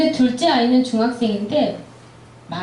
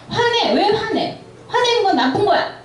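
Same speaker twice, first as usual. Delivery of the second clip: much higher, hammed-up scolding of a child; mock scolding parent; 10 semitones higher than usual. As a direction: snap at the kid like a strict parent, '화내는' pushed up high. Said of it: "어렸을 때부터".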